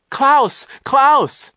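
high, loud calls for help; repeated high-pitched shouts; desperate cry. A narrator's reading. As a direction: cry out the name urgently. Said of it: "Isadora cried".

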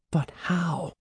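soft, high, anxious question; hushed high query; whispered reply. A narrator's reading. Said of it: "Andy whispered back".

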